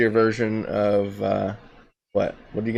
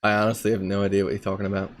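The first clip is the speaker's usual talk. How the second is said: quick, put-on English accent, playing innocent; deadpan mock-English denial; 3 semitones lower, 4 dB quieter, faster than usual. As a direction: deny it briskly in a fake English accent. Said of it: "talking in an English accent".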